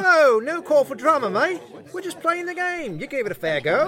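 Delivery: bad Australian accent. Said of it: "I know my accent sucks".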